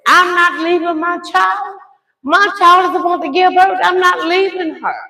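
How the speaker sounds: high-pitched indignant insistence; re-enacted protest, pitch held high; insistent refusal. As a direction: stand your ground with the operator. Retold refusal to operator.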